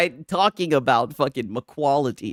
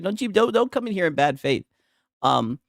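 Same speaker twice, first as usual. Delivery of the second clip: usual speaking voice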